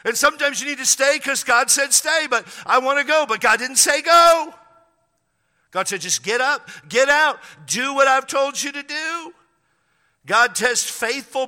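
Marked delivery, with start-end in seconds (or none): 0.0-9.3 loud, high, driving sermon delivery; full-force preaching. Acted. Preacher urging the congregation, full force and loud. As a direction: preach it hard, pounding each go.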